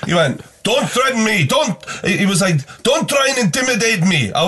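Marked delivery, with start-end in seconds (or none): 0.6-1.8 high-pitched defensive protest; acted indignant driver. Cab driver protesting at the passenger, acted indignation. 2.8-4.3 high, insistent protest; acted indignant driver. Cab driver standing up to the passenger, acted indignation.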